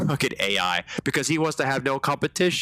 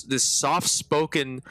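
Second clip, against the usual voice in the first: ordinary talk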